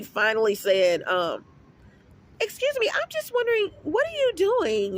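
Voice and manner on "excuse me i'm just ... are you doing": high, softer put-on woman's voice; mock her prying question in a high voice; mimicked nosy woman.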